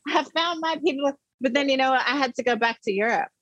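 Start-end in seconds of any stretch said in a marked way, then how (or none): 0.1-1.1 high, elated cry of discovery; teen girl thrilled after learning the electric slide, pitched high; overjoyed. Retold or imitated. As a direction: say it like you finally belong, voice soaring. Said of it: "I'm just like".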